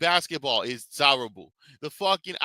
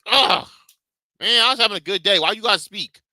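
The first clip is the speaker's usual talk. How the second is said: higher and louder, fed-up whine; annoyed groan; 8 semitones higher, 7 dB louder than usual. Retold or imitated. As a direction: play the irritated listener, fast and exasperated. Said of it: "Literally me".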